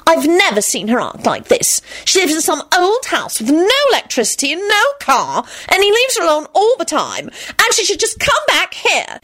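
high, insistent complaint; worked-up, peevish protest; irritable. A narrator's reading. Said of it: "Solange snapped irritably".

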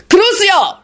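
high, forceful spell cry; roar. A narrator's reading. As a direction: roar the spell, pitch shooting up. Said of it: "Radu roared".

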